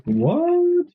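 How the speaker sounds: very high, stretched disbelief; astonished. Real, not acted. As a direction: shoot the pitch way up in real surprise. Reacts to a viewer's time zone.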